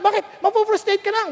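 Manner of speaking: ordinary delivery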